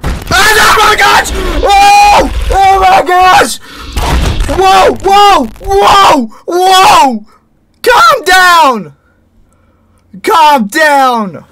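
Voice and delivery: very high, loud shrieks; frantic high yelling at an attacker; panicked screaming. Real, not acted. Jump scare, panicked screaming.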